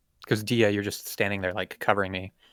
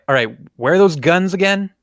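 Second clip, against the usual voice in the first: higher and louder call across the ship in a mock yell; 8 semitones higher, 6 dB louder than usual. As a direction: shout to the whole crew, looking left and right. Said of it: "like, yell out".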